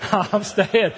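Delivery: giggling firm repeat; laughing harder. Real, not acted. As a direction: laugh through the promise.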